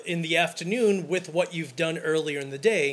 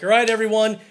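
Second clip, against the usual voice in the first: loud, pushy call to get everyone moving; calling the group to move; 3 semitones higher, 6 dB louder than usual. Retold or imitated. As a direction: raise the voice like rounding up a wedding party. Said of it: "I will voice my opinion".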